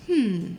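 thoughtful low hum of approval; a considering, chin-stroking hmm; intellectual hmm. Shown as a demonstration. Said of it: "the intellectual sign of approval, which kind of goes".

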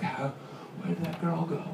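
softly mimicked wonder; hushed, as if baffled by a magic trick; awed hush. Acting a crowd awed by magic.

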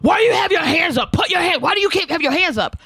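high-pitched mock yell; yelling officer. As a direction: imitate an aggressive cop shouting orders. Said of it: "you're yelling like there's something".

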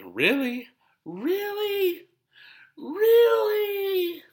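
building disbelief into a yell; mock outrage. Mocking outrage at a homeboy passing the joint, high and loud.

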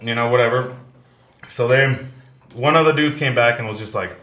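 normal talking voice

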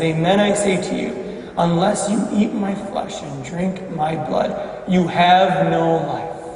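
low, soft and grave, slowing at the end; hushed solemn repetition. Acted. Jesus repeats his hard teaching to doubters, hushed and solemn. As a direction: let it sink in quietly, deadly serious.

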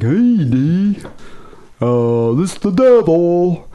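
high, slow, goofy mock voice; hammed-up imitation of a hapless devil; Gilligan. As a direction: drag it out high and dopey. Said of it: "I was thinking Gilligan".

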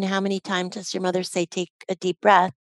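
normal talking voice

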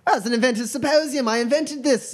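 high-pitched huffy magician; indignant inventor trickster. Acted. Magician defending his invention to a customer, high indignation. Game master plays offended magician.

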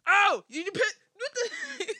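high, loud mock outburst breaking into laughter; retold yelling. Parent steps in the child's mess, mock yelling. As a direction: yell it in sputtering disbelief, half laughing. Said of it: "He's sitting there yelling".

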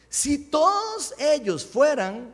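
high, loud, slow proclaimed verse; scripture reading. Real, not acted. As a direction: read it out forcefully, stretching key words. Reads scripture aloud. (lectura bíblica enérgica, lenta y en voz alta)

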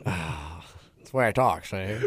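lightly voiced old-timey mobster; a hint of a movie gangster; fifties gangster voice. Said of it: "fifties just gangster".

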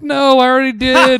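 high, loud retold protest; exasperated. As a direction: re-enact an exasperated yell at the landlord. Retold retort to landlord's useless advice.

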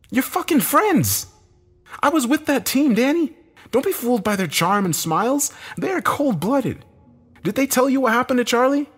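loud, high, strained accusation; heated, high-pitched denunciation; bitter outburst. A narrator's reading. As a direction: warn him angrily with pitch way up. Bitter warning about his former team.

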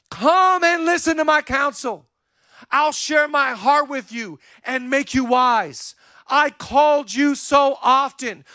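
high, slow, impassioned scripture reading; Holy Spirit appealing. Acted. Holy Spirit reproaching those who wouldn't come, impassioned appeal. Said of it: "Do you hear the voice of the Holy Spirit?".

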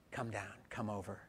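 hushed and kind; soft invitation. Acted. Jesus inviting the outcast home, soft.

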